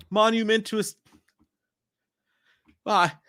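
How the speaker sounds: word hunt, voice climbing high. Real, not acted. Struggling for a word, voice rising.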